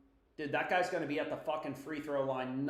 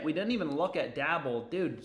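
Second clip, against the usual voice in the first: usual speaking voice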